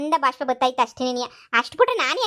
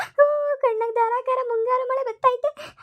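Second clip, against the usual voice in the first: high, wailing comic weep; mock tearful lament; hammed-up crying; 10 semitones higher, slower than usual. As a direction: sob it out, much higher than usual. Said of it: "ದುಃಖ ತಡ್ಕೊಳಕ್ ಆಯ್ತಿಲ್ಲ ನನ್ಗೆ".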